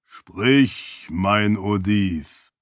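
langsam, gedehnt gesprochen, leicht verfremdet; Stimme des Wirns. Acted. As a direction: als ferner Herrscher durch die Kugel sprechen. Wirn antwortet durch das Seon. (Herrscher spricht durch das Seon zu Heraten, langsam und gedehnt)

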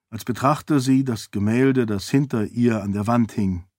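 ordinary delivery

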